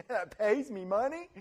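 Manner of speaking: ordinary talk, nothing marked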